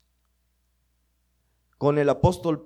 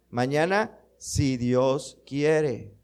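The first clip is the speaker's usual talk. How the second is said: model answer; 2 semitones lower, much slower than usual. Shown as a demonstration. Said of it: "¿qué deberíamos contestar?".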